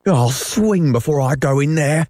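stubborn sailor digging in; dogged. A narrator's reading. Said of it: "replied Starkey doggedly".

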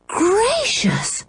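put-on high, breathless girl's surprise; amazed gasp. A narrator's reading. Girl amazed at a boy's paintings, high and breathless. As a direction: gasp it out in wonder. Anne amazed by Martin's pictures.

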